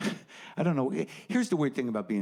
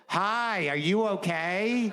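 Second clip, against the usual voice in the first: mother on the phone; 6 semitones higher, much slower than usual. Retold or imitated. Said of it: "my mother will call from Florida".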